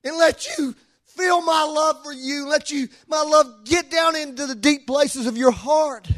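high, pleading proclamation in God's voice; impassioned preaching. Acted. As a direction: urge the congregation as God pleading for their love.

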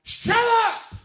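high, very loud yell; scream. Retold or imitated. Jesus screams at the storm, very loud and high. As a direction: bark it at the storm. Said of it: "and he screamed".

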